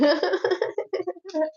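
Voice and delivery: high laugh. Real, not acted. Laughs at her own skit.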